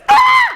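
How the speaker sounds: high loud shocked yelp; startled yelp. Retold or imitated. Boyfriend yelps seeing the mom's face, shocked squeal. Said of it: "like an asshole, I do this".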